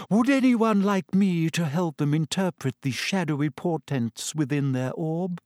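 normal talking voice

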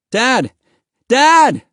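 desperate, high rising yell; crying out. A narrator's reading. Boy calling to his vanished father, desperate high cry. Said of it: "Mahmud cried".

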